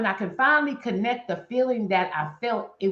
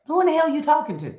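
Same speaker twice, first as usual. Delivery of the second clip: higher, fast, sassy comeback; indignant retort; 7 semitones higher, faster than usual. Real, not acted. To family telling her to get over it, a high sassy comeback. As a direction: clap back at them.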